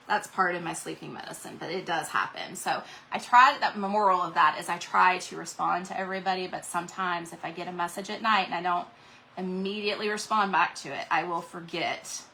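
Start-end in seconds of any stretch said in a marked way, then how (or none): none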